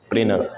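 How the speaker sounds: "प्रिंटर" said as prinner; dropped t. Shown as a demonstration. Teacher gives American form, "प्रिंटर" said as prinner. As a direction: drop the t in "प्रिंटर", falling. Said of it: "इनमें गायब होना है".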